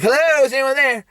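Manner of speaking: high mock woman's call. Waitress alone at closing time, high imitated calling out. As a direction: call high into an empty place. Waitress calls into empty restaurant.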